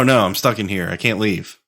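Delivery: normal talking voice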